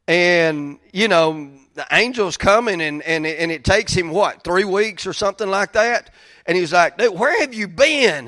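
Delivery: full-volume preaching peak; preaching at full force. Acted. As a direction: drive the sermon home with fire. Preaching climax.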